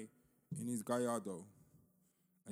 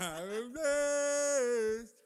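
high, loud, very slow preacher cry; shrill mock blessing; 11 semitones higher, 11 dB louder, much slower than usual. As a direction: wail it out like a pastor.

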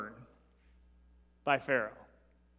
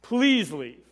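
pleading Pharaoh; much slower than usual. Retold or imitated. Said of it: "Not only does he say".

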